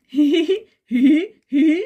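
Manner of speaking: loud, bursting hee-hee laugh; loud laughter. Acted. क्यों हंस रही हो. (ज़ोर की हँसी)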